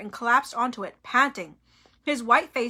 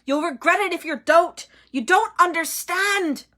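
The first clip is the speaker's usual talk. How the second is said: higher, pressing urgency; urgent plea; 6 semitones higher, 5 dB louder than usual. A narrator's reading. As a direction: urge him to listen. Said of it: "a note of urgency in his voice".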